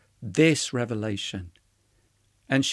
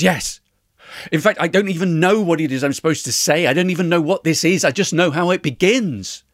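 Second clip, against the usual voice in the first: fast, loud, higher tumble of words; urgent, racing revelation at raised volume; excited outburst; 5 semitones higher, 8 dB louder, faster than usual. A narrator's reading. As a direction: blurt it out as it rushes to you. Retold breathless outburst of revelation.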